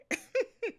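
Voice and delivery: short squealing laugh; high laugh. Real, not acted. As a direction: let out a high giggle. Laughing at her exercise joke.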